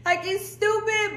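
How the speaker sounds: high, whiny imitation of tearful confusion; mock crying. Telling boyfriend she doesn't know why she's crying, mock-tearful whine. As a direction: play up a helpless, high-pitched crying whine. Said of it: "Why are you crying?".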